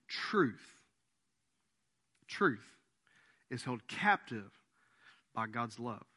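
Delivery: low, slow weighty declaration; quiet weighty pronouncement; solemn statement. Real, not acted. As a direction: lay down the truth slowly and gravely. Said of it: "I'm gonna make a statement".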